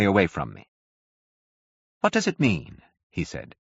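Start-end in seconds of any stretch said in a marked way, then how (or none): 2.0-2.8 abrupt curate. A narrator's reading. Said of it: "He spoke abruptly".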